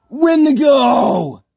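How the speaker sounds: high, drawn-out furious shout; raging. Acted. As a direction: scream the name in exasperation, stretched out. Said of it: "Stop raging".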